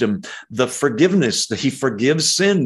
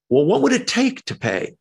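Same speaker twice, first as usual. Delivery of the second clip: lightly voiced questioner, pitched much higher; puzzled questioner; 12 semitones higher than usual. Preacher voicing a listener's objection, puzzled questioner. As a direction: ask it high, as a puzzled listener would.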